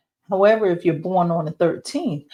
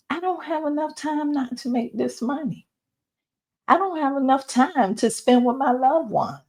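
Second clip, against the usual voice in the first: lightly imitated inner complaint, higher pitch; voiced self-talk; 7 semitones higher than usual. Reader voicing what listeners shouldn't tell themselves, light high-pitched self-talk. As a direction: mimic a self-doubting excuse, pitched up. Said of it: "not telling yourself that".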